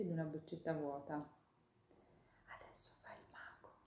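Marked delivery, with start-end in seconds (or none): 2.5-3.7 soft secret whisper in the ear. Acted. Ladybug whispering to Chiara while the hawk works. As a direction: lean in and share a secret. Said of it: "sussurrò all'orecchio di Chiara".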